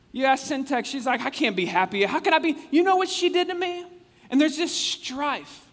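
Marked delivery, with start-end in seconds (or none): 1.2-3.9 much higher, louder indignant wail; outraged churchwoman. Retold or imitated. Preacher voicing Syntyche's grudge, high indignant put-on voice. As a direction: squeal out the grievance in a put-on voice. Acted quarrel, Syntyche's complaint.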